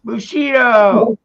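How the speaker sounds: loud, slow drawn-out call. Real, not acted. Streamer shouting a friend's name to get his attention, loud and drawn out. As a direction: yell the name across the room.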